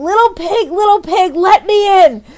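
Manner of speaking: put-on high, loud squealing children; mimicking two little girls squealing the pig story; squealing kids. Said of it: "I hear them squealing".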